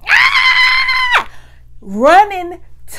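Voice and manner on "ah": very high shrieking ah; scream. Retold or imitated. Wig falls off in the restroom, high scream. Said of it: "I'm screaming and hollering".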